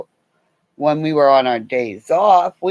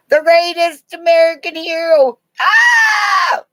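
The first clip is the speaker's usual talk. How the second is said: put-on high excited kids' shout at full voice; kids shouting; 13 semitones higher, 6 dB louder than usual. Kids at the park mobbing the curly-haired guy, high excited shouting. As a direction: shriek it like kids running to their hero.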